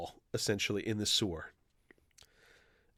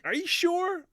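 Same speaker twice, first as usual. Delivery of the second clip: high doubtful question. Retold or imitated. Batman voiced in retold scene.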